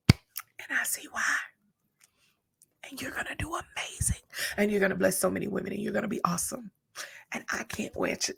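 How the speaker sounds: choked, breathy whisper through tears; tearful whisper. Real, not acted. Mentor blessing the young woman she mentored, tearful whisper. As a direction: hold back the tears as you speak. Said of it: "feel that from my heart".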